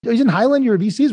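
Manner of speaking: mock-shrill angry executive on a call; Doug's indignant voice. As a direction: play the CEO as outraged and incredulous. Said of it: "Doug comes off of mute".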